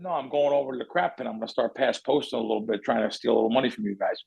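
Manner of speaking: low, soft deadpan. Retold or imitated. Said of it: "deadpan [name] face".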